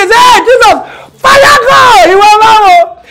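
mock driver yell. The Uber driver shouting back at his scared passenger, hammed-up yell. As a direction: bellow it like a driver losing patience.

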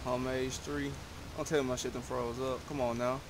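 ordinary talk

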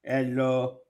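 slow, monotone robot voice; stiff, evenly spaced robotic delivery; robot account. Acted. Host jokingly voices the reply guy as a robot, droning slowly.

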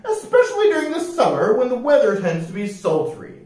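high, prim and proper voice; un-piratelike rascal. A narrator's reading. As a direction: explain it very properly, pitched high. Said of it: "answered the man".

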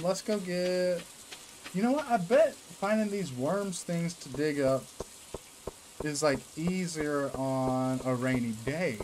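slow Southern drawl; country drawl. Real, not acted. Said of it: "Why do I sound country right now?".

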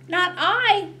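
high, loud piggy squeal. Acted. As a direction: squeal it like a little pig. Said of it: "squealed the pig".

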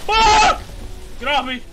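high loud panicked shriek; panicked scream. Real, not acted. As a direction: scream as something grabs you. Attacked in game.

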